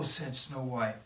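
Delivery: usual speaking voice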